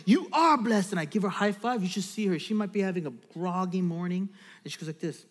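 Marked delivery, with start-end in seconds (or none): 0.1-0.9 lightly raised, emphatic reply; father's affirmation. Retold or imitated. Dad affirms his little daughter with a high five, emphatic reply. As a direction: answer back with stress on "are". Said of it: "And then I go".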